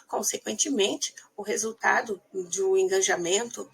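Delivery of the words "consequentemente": normal talking voice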